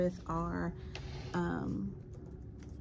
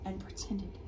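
slow hushed whisper; 12 dB quieter, slower than usual. Acted. Teacher whispering the hiding part of the story to the class. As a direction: whisper like they're hiding inside.

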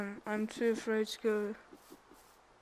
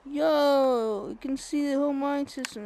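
excited discovery; 4 semitones higher, 9 dB louder than usual. Real, not acted. Discovers big cave.

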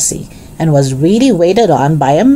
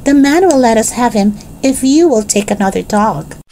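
a hint of a higher girl's voice; higher-pitched, hopeful young figure; lightly voiced Jessie, eager; 7 semitones higher than usual.

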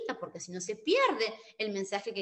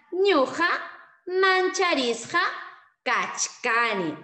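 Ñuqa Mancharisqa kachkani slow, loud and high, word by word; slow loud model; 7 semitones higher, 6 dB louder, slower than usual. Shown as a demonstration. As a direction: drill it slowly for the class. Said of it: "Entonces será".

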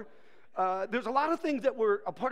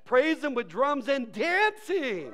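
slow, high, shouted proclamation; exultant preacher at full force; triumphant shout; 6 semitones higher, 6 dB louder, slower than usual. Acted. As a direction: shout the verse at the doubters. Answers objector with verse.

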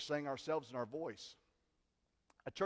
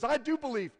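high, loud cry of faith; a father crying out to Jesus; cried out; 11 semitones higher, 8 dB louder than usual. Acted. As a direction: cry it out desperately. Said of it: "the boy's father cried out".

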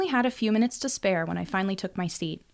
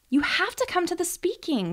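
demanding; 11 semitones higher than usual. A narrator's reading. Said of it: "Callie demanded".

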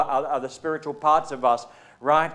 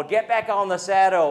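louder, higher hearty push; mock pep talk; 7 semitones higher, 8 dB louder than usual. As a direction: cheer it like a gruff coach. Quoting a pep-talk phrase.

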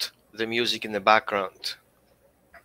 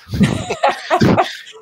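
laughing; 4 dB louder than usual. Real, not acted. Laughing at the dog's win.

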